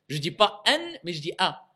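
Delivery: ordinary talk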